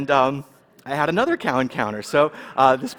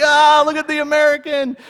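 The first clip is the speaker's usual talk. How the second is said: mocking bystander; 13 semitones higher, 9 dB louder than usual. Crowd laughing at him.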